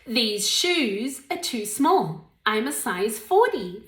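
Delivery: slow, clear teacher model; deliberate classroom drill; slow model sentence. Shown as a demonstration. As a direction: spell it out slowly for the class.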